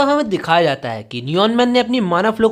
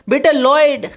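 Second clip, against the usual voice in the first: frightened cry; 5 semitones higher, 3 dB louder, slower than usual. A narrator's reading. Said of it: "उसकी mom डरते हुए कह रही होती हैं".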